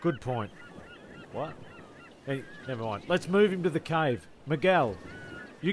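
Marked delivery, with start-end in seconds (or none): none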